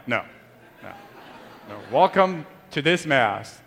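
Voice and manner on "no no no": ordinary talk, nothing marked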